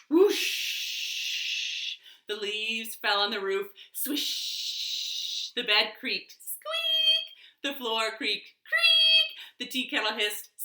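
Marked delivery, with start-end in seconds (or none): 0.1-2.0 breathy wind whoosh. Pretend. Wind noise effect. 3.9-5.5 swish like falling leaves. Pretend. Leaves effect. 6.3-7.3 high squeak. Pretend. Squeak effect. 8.6-9.3 high creak. Pretend. Creak effect.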